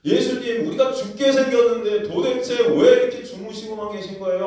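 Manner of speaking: high, loud pleading protest; panicked complaint. Acted. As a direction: cry out to a sleeping master. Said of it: "아마 불평, 불만을 막 쏟아냈을". (겁에 질려 큰 소리로 따지듯)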